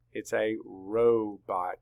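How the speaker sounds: ROBOT slowly split ROE-bot; open syllable. Shown as a demonstration. Teacher confirms the split for students, ROBOT in slow syllables. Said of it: "making an open syllable here".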